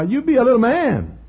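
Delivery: high, loud motherly urging; put-on high, insistent mama voice; imitating his mother. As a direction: play mama bucking up her boy. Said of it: "how you used to say to me".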